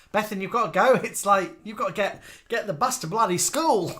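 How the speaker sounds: loud, high mock Aussie rant; Australian accent. Teasing Bethan to go to school, mock Australian accent. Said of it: "We've been doing Australian accents to you all evening".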